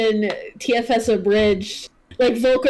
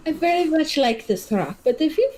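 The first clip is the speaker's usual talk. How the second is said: NPC line in the GM's favorite put-on accent, pitched higher; 5 semitones higher than usual. GM voices the rock-holding NPC to the party, in the wrong accent. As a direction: slip into a stock favorite accent, a touch high. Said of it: "That's my favorite accent to do, but it's not her accent".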